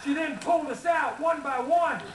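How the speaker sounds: folksy Texas twang; Texas accent. Acted. Folksy rancher telling family stories to a crowd, Texas accent.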